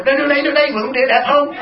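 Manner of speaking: high, eager, laughing show-off; proud showing off. Retold or imitated. Wife proudly showing off husband's pants, high and laughing. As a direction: squeal with delight as you show them.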